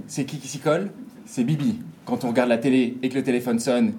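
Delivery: normal talking voice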